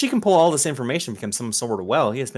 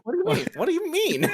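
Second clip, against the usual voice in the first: mock high voice; faster than usual. High mocking repeat.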